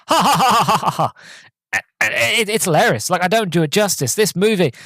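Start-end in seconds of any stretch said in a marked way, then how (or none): 0.1-1.1 high forced ha ha ha; hammed-up canned laughter; a chorus of fake laughs; fake group laugh. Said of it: "And they go".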